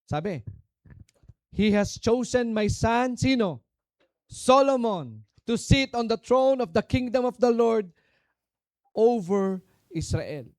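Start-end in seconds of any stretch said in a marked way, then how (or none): 1.6-10.5 1 proclaiming loudly. Acted. Preacher reading David's words aloud.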